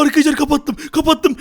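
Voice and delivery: ordinary talk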